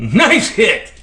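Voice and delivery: high, impressed exclamation; excited praise. Real, not acted. Praising the snake's strike.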